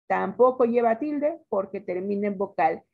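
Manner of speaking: ordinary delivery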